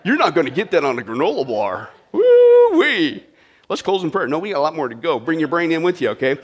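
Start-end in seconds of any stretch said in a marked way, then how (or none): 2.1-3.2 high, loud exclamation of delight; excited woo-wee. Real, not acted. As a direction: whoop like you're impressed. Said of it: "that's pretty deep".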